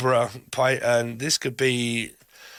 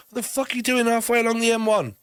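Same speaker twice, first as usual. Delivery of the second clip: exasperated; 9 semitones higher, faster than usual. Pretend. Voicing exasperated self.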